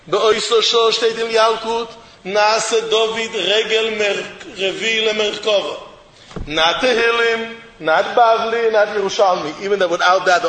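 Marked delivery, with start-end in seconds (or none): none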